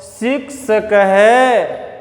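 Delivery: छात्र है drawn out, visarga breathed as है; slow visarga reading. Shown as a demonstration. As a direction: model it slowly. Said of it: "फिर दूसरी line में आ जाओ".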